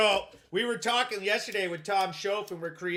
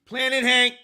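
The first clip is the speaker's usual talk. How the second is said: hype announcement; 4 semitones higher, 6 dB louder than usual. Acted. Host announcing his show name.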